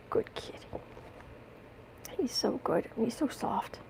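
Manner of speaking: quiet whisper to a pet; whispering to cat. Real, not acted. Owner whispering about her soft cat. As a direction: murmur it to the kitty.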